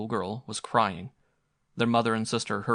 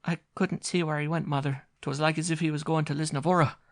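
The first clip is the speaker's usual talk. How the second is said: put-on high, timid child voice; child telling the mother; small child; 7 semitones higher, 4 dB louder than usual. A narrator's reading. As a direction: answer the mother hesitantly.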